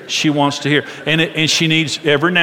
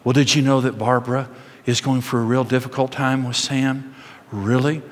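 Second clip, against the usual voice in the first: gossiping women; 3 semitones lower, 5 dB quieter than usual. Retold or imitated. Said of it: "they're saying".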